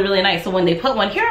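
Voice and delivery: ordinary talk, nothing marked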